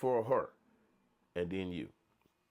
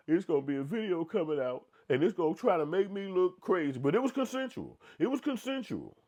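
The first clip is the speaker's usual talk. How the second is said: high, pleading put-on voice; mock the nervous apology, pitched high; hammed-up apology voice, much higher; imitating his apology; 9 semitones higher, 4 dB louder than usual. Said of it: "he said in his motherfucking apology".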